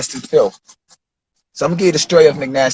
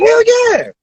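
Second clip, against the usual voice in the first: eager yes. Retold or imitated. Rapper accepting a video offer, thrilled. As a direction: jump at the offer. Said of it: "I'm like".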